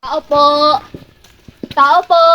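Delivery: calling out toward the house; calling from far away. Real, not acted.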